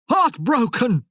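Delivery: loud, high anguished shout. Acted. Merlin shouting his grief at Tamara, loud and high. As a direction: fling the word out in despair. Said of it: "he shouted".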